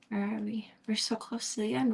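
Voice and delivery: unvoiced whisper. Real, not acted. Reader checking the chapter, whisper. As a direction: hush the question.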